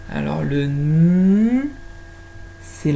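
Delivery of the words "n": "N" as a long hummed nnn, not the letter name; "N" sounded as a drawn-out nnnn. Shown as a demonstration. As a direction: hold "N" as a rising hum. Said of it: "la lettre qui fait le son".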